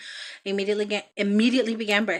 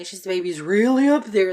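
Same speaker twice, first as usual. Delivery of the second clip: doctor's voice; 6 dB louder than usual. Retold or imitated. Doctor quoted during her C-section.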